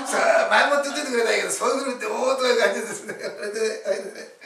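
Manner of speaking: loud, high, laughing protest; real laughter breaking into the words; laughing while talking. Real, not acted. Recounting being put on the spot.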